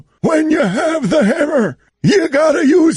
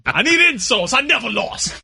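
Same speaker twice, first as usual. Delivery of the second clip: LeVar Ball impression; 4 semitones lower than usual. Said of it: "[name]'s fake LeVar Ball".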